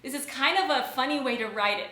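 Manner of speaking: normal talking voice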